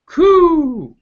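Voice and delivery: high, loud cooing; dove coo. Acted. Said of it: "that's the dove".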